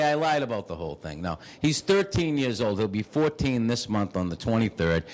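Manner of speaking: ordinary delivery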